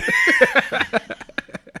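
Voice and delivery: shrieking laugh; high laugh. Real, not acted. Big laugh at the story.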